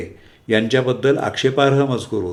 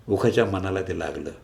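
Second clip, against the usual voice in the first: ordinary delivery, nothing marked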